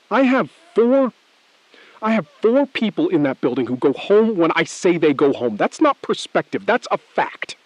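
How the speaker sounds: loud, high furious threat; angry outburst. Acted. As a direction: explode at him, leaning hard on four. Hostage taker asserts leverage.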